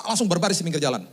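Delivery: usual speaking voice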